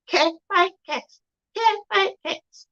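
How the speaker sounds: lilting name callout; sing-song handle. Real, not acted. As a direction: chant the handle up high. Repeating her Instagram handle.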